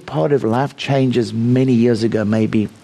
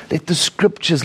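ordinary delivery

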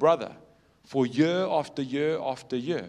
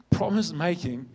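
usual speaking voice

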